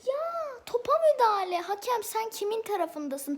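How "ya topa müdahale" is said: high, loud protest at the referee; indignant cry of protest; outraged shout. Real, not acted. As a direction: yell the call is wrong.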